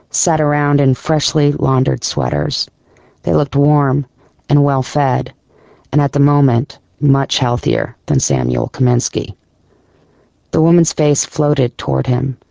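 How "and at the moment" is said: usual speaking voice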